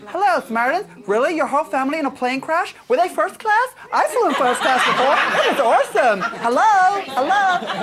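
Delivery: high, airy mock Samaritans phone voice; hammed-up ditzy helpline voice; Tracy impression. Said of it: "I've got this impression that she's gonna work for the Samaritans".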